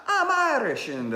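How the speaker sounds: mock Irish accent. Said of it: "I was like".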